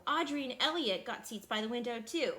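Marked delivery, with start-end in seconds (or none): none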